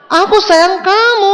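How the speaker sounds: shouted love line. Retold or imitated. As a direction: bellow it from the back of a motorbike. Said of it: "cowoknya bilang".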